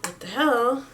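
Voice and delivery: alarmed words kept quiet while unloading the trunk; panicked under breath. Retold or imitated. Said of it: "So I'm freaking out".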